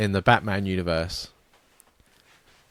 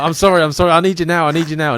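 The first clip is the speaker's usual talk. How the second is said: pleading; 7 semitones higher, 8 dB louder, faster than usual. Acted. Acted Batman pleading with Alfred.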